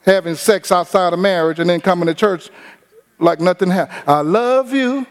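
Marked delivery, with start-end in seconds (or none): 4.1-5.1 slow, crooning mock worship to the Lord; hammed-up slow devotion that turns sly; mock tender prayer. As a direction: drag out the love you like a sweet-talking hypocrite. Mocking hypocrite's prayer.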